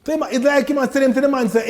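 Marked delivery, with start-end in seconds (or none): none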